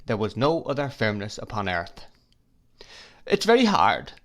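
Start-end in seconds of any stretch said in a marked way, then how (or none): 3.3-4.1 hurt complaint. Acted. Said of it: "said my mother".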